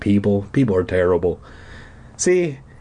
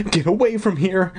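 cried out; 9 semitones higher than usual. Acted. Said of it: "she cried".

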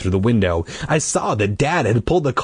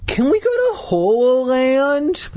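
put-on high, whiny kid voice; demanding child. A narrator's reading. Boy demanding to go to Horrorland, whiny. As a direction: whine it up high, insistently. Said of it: "Luke demanded".

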